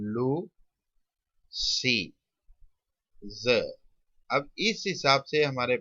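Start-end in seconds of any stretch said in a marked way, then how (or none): 0.1-3.6 "लू, सी, ज" with long gaps; slow sounding-out. Shown as a demonstration. Teacher writing the outline for students, slow sounding-out. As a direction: give each sound of "लूसीज" on its own.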